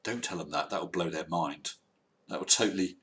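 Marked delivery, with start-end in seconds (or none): none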